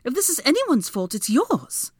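high, loud blame; accusing retort. A narrator's reading. Woman blaming her rival mid-quarrel, accusing retort.

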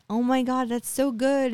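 high, happy retold exclamation; imitated delight. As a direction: rejoice as the husband hearing good news. Husband delighted by pregnancy news.

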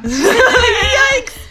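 loud high laughing yelp; laughing yikes. Real, not acted. Laughs at the podcast joke.

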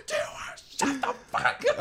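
high, loud mock shout; exasperated yell, close to laughing; drill instructor yelling. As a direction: imitate an instructor shutting a recruit down. Drill instructor yells again.

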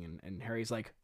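ordinary talk, nothing marked

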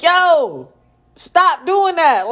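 high, slow retold reprimand; exasperated scolding. Mom to daughter cursing in the car, exasperated scolding. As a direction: drag it out like a fed-up parent. Mother reacting to child's cursing.

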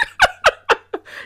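high, amused burst of laughter; real laugh. Real, not acted. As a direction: laugh at your own acting. Laughing at own acted plea.

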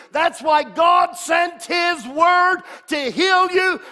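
full-force preaching. Acted. Preacher rousing his congregation, shouting at full force. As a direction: thunder it from the pulpit.

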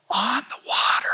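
awed whisper, the words on the water breathed out. Acted. Whispered repeat of a phrase.

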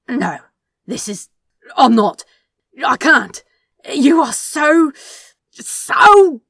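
loud, broken panicked outburst; frantic refusal. Acted. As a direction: stammer it out, losing control.

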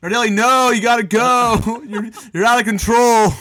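a put-on coach shooing a student away; imitating the teacher. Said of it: "That's how he talked, too".

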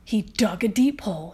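ordinary delivery, nothing marked

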